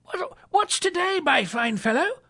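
high, excited question; eager Scrooge. A narrator's reading. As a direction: call it out high and jovial. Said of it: "said Scrooge".

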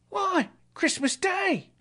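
put-on very high, drawn-out boyish reply; high boy's voice stating the obvious; street boy. A narrator's reading. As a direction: answer as an amazed lad, slowly. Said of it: "replied the boy".

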